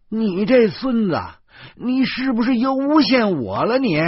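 high, loud furious cursing at him; angry cursing. A narrator's reading. Grabbing the informer by the collar, cursing him out furiously. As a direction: tear into the man who framed you. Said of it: "我嘴中骂".

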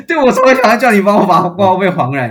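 loud and indignant about the fee; indignant outburst. Real, not acted. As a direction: blurt it out in outrage. Indignant retelling of refund wish.

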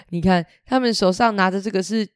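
ordinary delivery

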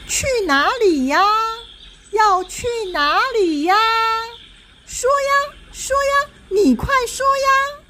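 high-pitched demanding doll; pushy little doll. Acted. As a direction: nag him in a tiny high voice. Doll pesters the ghost general.

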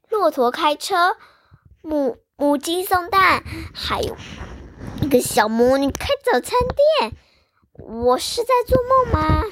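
wondering if it's a dream; astonished boy. Acted. Boy stunned, doubting.

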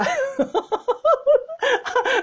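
high-pitched mock-sneaky giggle; sly sneaky laugh. Imitating him going off to fetch snacks, sly sneaky laugh. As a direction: imitate a sly, suppressed cackle. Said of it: "就是鬼笑着".

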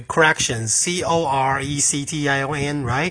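slow letter-by-letter spelling. Shown as a demonstration. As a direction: spell each letter out slowly for the class. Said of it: "那它怎么拼？".

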